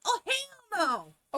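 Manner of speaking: high, slow, drawn-out hell no; mock outrage, stretch it out; emphatic refusal. Refuses the skirt.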